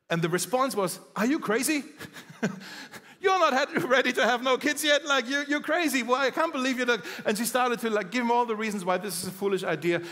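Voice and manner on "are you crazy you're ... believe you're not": mother-in-law's outburst. Retold or imitated. Mother-in-law scolding the news.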